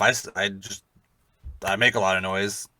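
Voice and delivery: ordinary delivery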